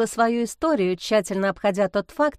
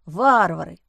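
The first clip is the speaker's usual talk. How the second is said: lower, weighty condemnation; emphatic verdict; 4 semitones lower, 4 dB louder than usual. A narrator's reading. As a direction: stamp it down like a verdict. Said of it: "припечатал человечек". (припечатать низким голосом)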